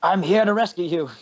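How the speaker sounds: high put-on hero call; rescuer speaking Gnomish. Player tells the trapped gnome help came, high put-on voice. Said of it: "I'll say in Gnomish".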